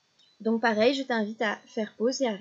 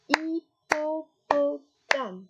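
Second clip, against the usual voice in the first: "hippopotame" slowly, higher, in four separate beats; syllable by syllable; 5 semitones higher, much slower than usual. Shown as a demonstration. Teacher counting syllables for a child, "hippopotame" slowly split. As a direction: break "hippopotame" into hip-po-po-tame, evenly spaced. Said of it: "un long mot avec quatre syllabes".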